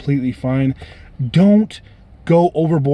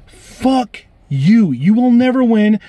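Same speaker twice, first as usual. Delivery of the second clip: defiant outburst; 7 semitones higher, 3 dB louder, much slower than usual. Real, not acted. Ranting at prank callers.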